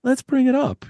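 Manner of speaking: jumps way up high in mock falsetto; imitating narrators who pitch up for women. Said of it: "when we do female voices".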